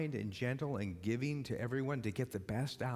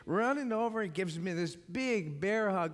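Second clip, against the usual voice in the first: ordinary talk, nothing marked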